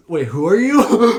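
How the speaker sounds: loud, high, drawn-out disbelief; mock bafflement. Mocking the hero not knowing the kid, loudly baffled. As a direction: call it out, incredulous and half laughing. Joke line at unknown kid.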